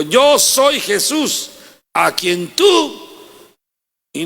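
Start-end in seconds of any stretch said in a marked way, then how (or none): 0.0-3.1 high, slow declaration; voice of Jesus. Acted. Jesus answering Saul, slow and solemn. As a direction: proclaim it slowly, with weight. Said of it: "Porque Jesucristo dijo".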